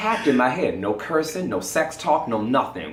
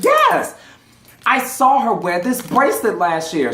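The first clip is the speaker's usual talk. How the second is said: insistent; 9 semitones higher, 4 dB louder than usual. Retold or imitated. Crystal insisting, retold.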